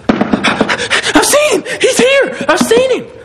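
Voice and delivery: very high, loud, stretched cries with a laugh; breathless joy. Acted. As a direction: shout the news out of breath, laughing. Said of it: "She's out of breath".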